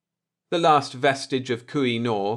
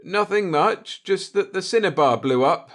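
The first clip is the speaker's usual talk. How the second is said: forced casual; 7 semitones higher, 4 dB louder than usual. Acted. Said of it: "fought the panic in his voice".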